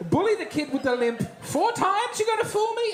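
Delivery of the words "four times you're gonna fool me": much higher, incredulous; hammed-up astonishment; mock disbelief. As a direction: squeal the question in disbelief. Comic disbelief.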